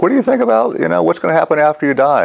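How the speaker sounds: high, eager questioning; mock the curious student; a hint of a high pestering voice; quoting the lady. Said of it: "She's like".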